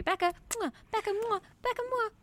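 rising playful air kisses; blowing kisses. Acted. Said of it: "do kissing noises into our microphone".

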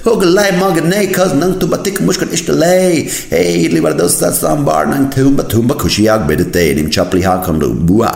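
high, hammed-up Kannada patter; showy hype voice, easing down toward the end; mock swagger, playing to the crowd in Kannada. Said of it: "imagine if people start speaking Kannada like that".

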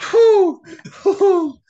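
loud exhale after laughing hard; breathless whew. Real, not acted. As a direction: blow out a big whew. Catching breath after laughing fit.

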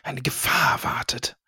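slow ominous whisper; spooky whisper. Pretend. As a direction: hiss it like a dark prophecy. Unheilvolle Deutung, geflüstert. (unheimlich geflüstert)

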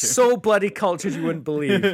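high, loud, hammed-up grandeur; mock haughty boast. Answering his co-host's teasing with a mock grand boast. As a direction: play up being cultured for a laugh. Mocking friend's "so cultured" jab.

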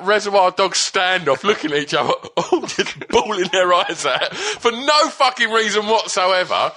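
ordinary talk, nothing marked

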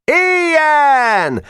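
very high, drawn-out hurrah; unanimous cheer. A narrator's reading. Assembly approving the motion, high shouted cheer. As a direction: cheer as if from every throat. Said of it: "hangzott egyszerre minden torokból".

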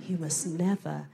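whispered warning; whispered insider advice; conspiratorial whisper. Acted. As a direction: lean in and whisper the secret. Warning fellow comedians.

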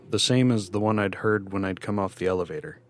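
ordinary delivery, nothing marked

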